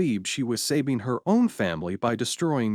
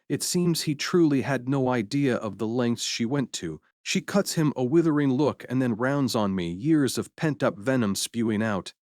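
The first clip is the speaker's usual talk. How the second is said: plain reading voice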